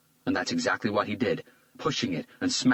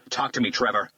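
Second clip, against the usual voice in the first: urgent shout; 4 semitones higher, 4 dB louder than usual. A narrator's reading. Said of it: "Hunter shouted".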